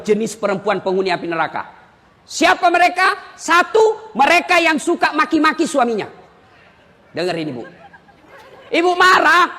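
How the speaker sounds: normal talking voice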